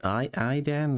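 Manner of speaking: a shaky, self-admitted bad Scots accent quoting a game character; amateur Scots accent impression; attempted Scots accent. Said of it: "I can't do a Scots accent".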